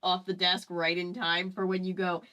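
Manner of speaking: ordinary talk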